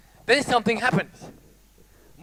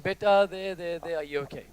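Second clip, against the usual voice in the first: comforting mother; 3 semitones lower, 8 dB quieter than usual. Retold or imitated. Mother comforting her child.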